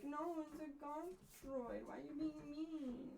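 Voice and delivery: high, soft, wounded little voice; hurt pleading. Retold or imitated. Answering the mean critic about the gonk droid, wounded. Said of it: "I'd be like".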